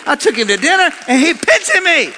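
loud, high-pitched indignant complaint; mock outrage. Pinched after buying the boy dinner, mock outrage. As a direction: protest loudly as if deeply wronged.

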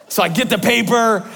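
ordinary delivery, nothing marked

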